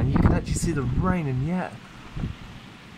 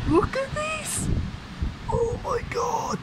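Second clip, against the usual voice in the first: excited; 4 dB quieter than usual. Real, not acted. Storm peak, squealing.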